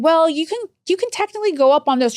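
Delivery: a hint of an excuse-making phone rep; imitated agency worker. Agency worker advising daughter on dosing, lightly mocked.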